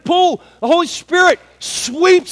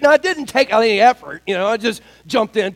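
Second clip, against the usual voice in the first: normal talking voice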